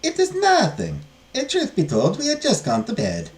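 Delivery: high, lilting nun voice easing down; reassuring nun. Acted. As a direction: brush off the apology, starting very high. Nun character voice.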